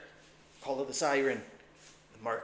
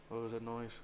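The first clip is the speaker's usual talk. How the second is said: usual speaking voice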